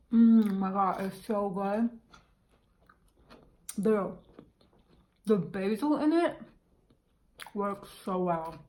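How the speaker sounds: talking through a mouthful; words round a bite of food; chewing while talking. Real, not acted. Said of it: "Sorry, I'm chewing with my mouth".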